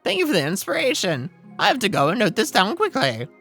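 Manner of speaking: high, loud, chirpy bird voice; bubbly songbird full of ideas; excited bird character. Acted. Game bird character speaks.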